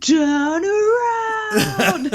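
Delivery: very high, very slow drawn-out call; hammed-up slow falsetto lure; mock siren. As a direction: stretch it out high and eerie.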